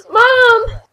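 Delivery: loud, high wail; mock cry. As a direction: call out loudly as if distressed. Calls out in mock distress.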